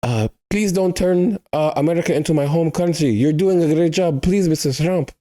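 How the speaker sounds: a fan with a thick put-on accent begs the president to keep his country out of America; mock foreign accent. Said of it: "let me go into my accent".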